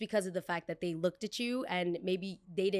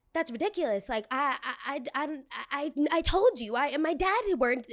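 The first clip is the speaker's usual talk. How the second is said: mock indignant stammer, higher; higher, flustered mock outrage; indignant guest; 5 semitones higher than usual. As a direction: splutter like an offended customer. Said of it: "her tone is changing up".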